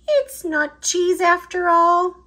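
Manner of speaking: sniffing. Acted. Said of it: "sniffed Pip".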